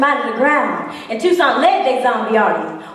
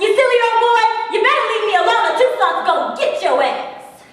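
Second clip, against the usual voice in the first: put-on girl's shout; 9 semitones higher than usual. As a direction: shout him off, brave and cheeky. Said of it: "and I shouted".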